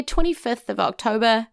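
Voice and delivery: usual speaking voice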